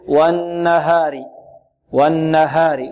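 slow chanted والنهار with a light h and a pure open a; correct pronunciation. Shown as a demonstration. Teacher giving students the correct form, light open ha. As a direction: recite والنهار with ها open, neither tilted nor heavy. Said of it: "الصحيح".

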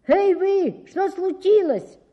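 loud, high call across the river; shouted call. Acted. Chimney sweep shouts to the noisy birds, a loud high call. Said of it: "крикнул трубочист".

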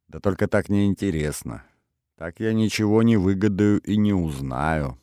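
plain reading voice

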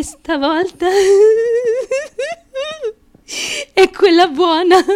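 choked up, cracking into a tearful laugh; overcome, half laughing half crying; voice breaking with emotion. Acted. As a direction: fight back tears on the hopeful words. Emotional audition confession.

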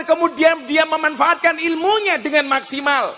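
high, loud sermon delivery; impassioned preacher's shout; full-force preaching. Real, not acted. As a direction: drive it home to the whole crowd.

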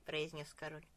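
ordinary delivery, nothing marked

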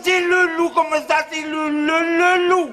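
wolf voice. Acted. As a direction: howl it out like a wolf. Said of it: "je sais faire le loup".

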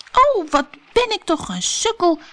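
high Alice voice, mock exasperated at herself; crying out. A narrator's reading. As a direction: exclaim at your own clumsiness. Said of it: "riep Alice". (hoog uitroepende Alice, geërgerd om zichzelf)

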